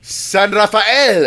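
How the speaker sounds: San Rafael as san ra-fa-EL, showy Spanish; Spanish pronunciation. Shown as a demonstration. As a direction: draw out Rafael in a Spanish accent, ra-fa-EL. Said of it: "when people call it San Rafael".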